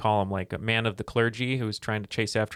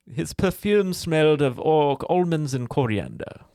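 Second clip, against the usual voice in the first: put-on slow, raised, theatrical weird accent; Mister Collins; 5 semitones higher, 4 dB louder, slower than usual. Performing a favourite play line on request, weird accent. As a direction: start loud and high in the odd stage accent, then ease down. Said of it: "Do, do the line that I love".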